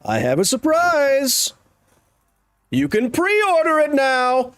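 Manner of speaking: high, slow, hammed-up announcer pitch; mock product launch. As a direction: sell it like a cheesy tech keynote.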